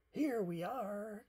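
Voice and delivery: high, loud, drawn-out call; high-pitched storybook duck cry. A narrator's reading. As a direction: call out an arrival, stretching the words. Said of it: "cried Duck".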